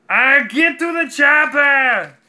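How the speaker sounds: high, slow, shouted Arnold voice like a bad Wookiee; bad Arnold impression. Shouting the chopper line in a botched Arnold voice. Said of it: "my Arnold just sounds like a bad Wookiee".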